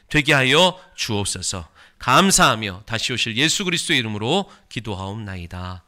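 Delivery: loud, high, impassioned closing prayer; fervent prayer. Real, not acted. Pastor praying over the congregation, loud and fervent. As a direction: pour the prayer out with full force.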